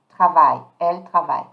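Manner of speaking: "travaille" modelled low, ending on "vai"; silent ENT. Shown as a demonstration. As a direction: sound out "Elle travaille" with no ENT. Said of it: "I'm not pronouncing the E N T".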